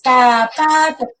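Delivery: "zapato" split slowly into za-pa-to; clapped syllables. Shown as a demonstration. Teacher showing parents how to clap "zapato", very slow. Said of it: "esa palabra se compone con tres".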